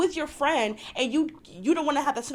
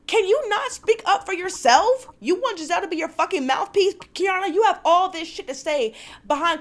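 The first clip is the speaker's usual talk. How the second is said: higher, louder, fed-up outburst; exasperated rant; 6 semitones higher, 3 dB louder than usual. Real, not acted. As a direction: call her out, irritated. Ranting at cast member on show.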